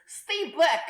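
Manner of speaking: put-on thick Russian accent, shouted high. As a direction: bark it like a Russian gunman. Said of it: "in a thick Russian accent".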